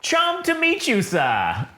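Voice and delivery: high, slow, polite droid. Acted. Droid greeting new masters, high and courteous. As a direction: sing out a formal greeting high, then drop. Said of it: "he talks just like C three P O".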